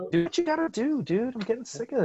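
high-pitched exasperated whine; fed up. Acted. Co-host griping about interruptions to the recording, fed up and high-pitched.

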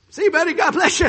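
high, very loud blurt; comic loud acted holler; retold shout. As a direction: call out to a drunk driver. Calling to the drunk driver.

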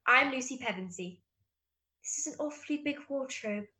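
British accent, in character; audition-style British accent; Lucy Pevensey. Shown as a demonstration. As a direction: deliver it as a British film heroine. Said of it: "British is".

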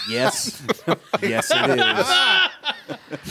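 laughing yes. Real, not acted. Agrees loudly, laughing.

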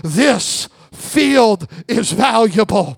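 slow, shouted revival sermon; full-force preaching. Acted. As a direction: preach it to the whole church.

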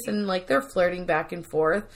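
ordinary talk, nothing marked

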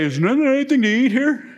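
imitating a bored hungry teen; whiny teenager. Teen sons asking for food.

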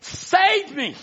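high, imploring cry. Retold or imitated. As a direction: cry out like the prodigal son, pitch leaping up. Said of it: "we cried out".

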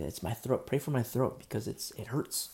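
ordinary talk, nothing marked

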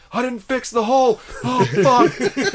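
very high, loud alarmed cry; laughing in-game panic; panicked. Retold or imitated. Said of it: "I'm like".

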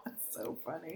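amused whispered aside; soft unvoiced giggle of a comment. Real, not acted. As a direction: breathe it out between laughs. Amused aside while laughing.

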